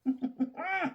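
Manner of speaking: high, soft laugh at herself; laughter at own rant. Real, not acted. Catching herself ranting, laughing.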